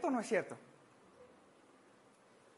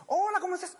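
chummy caller; 7 semitones higher, 5 dB louder, faster than usual. Retold or imitated. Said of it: "Contestas y dices".

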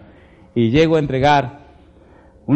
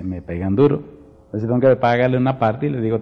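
ordinary delivery

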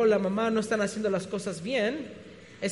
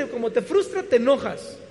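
ordinary talk

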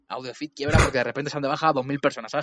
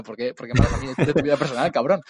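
louder, exasperated, swearing at him; mock indignant retort; 8 dB louder than usual. Listener told about his breakup, indignant loud retort. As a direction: snap it back like a fed-up listener.

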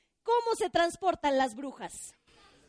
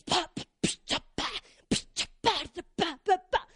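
mock blaring music; 2 semitones higher than usual. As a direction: make the music noise with your mouth. Imitating loud music from neighbours.

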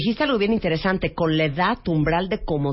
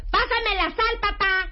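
shouting at dad; 13 semitones higher, slower than usual. Shown as a demonstration. Asking elderly dad for the salt, shouted the wrong way. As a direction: bellow it at him like he's deaf. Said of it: "No, no, no".

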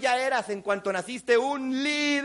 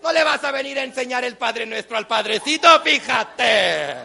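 indignant mother; 6 dB louder than usual. Retold or imitated. Mom rebukes son for giving orders, indignant tirade. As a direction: build the scolding to a shout. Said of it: "Y la mamá".

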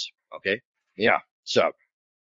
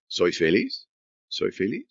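feliz lifted into a question at the end; rising question tune; 9 semitones lower than usual. Shown as a demonstration. Teacher showing a Spanish question by rising tune. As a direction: let the voice rise on feliz to ask. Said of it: "if you inflect your voice".

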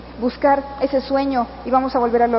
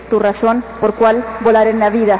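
rousing closing speech; 6 semitones higher, 4 dB louder than usual. Acted. Speaker sending off the convention crowd, building to a rally shout. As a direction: whip the crowd up louder with every line until you shout the farewell.

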